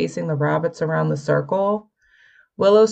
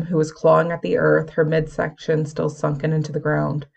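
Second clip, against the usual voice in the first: ordinary delivery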